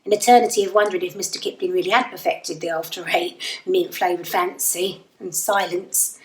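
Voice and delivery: plain reading voice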